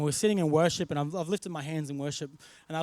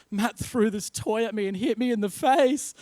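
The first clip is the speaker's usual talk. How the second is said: higher, put-on upset child's voice; tearful little girl; 5 semitones higher, 4 dB louder than usual. Tearful daughter runs to dad to tell on her brother, mock-upset. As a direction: play a small girl tattling through mock tears. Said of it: "just started crying".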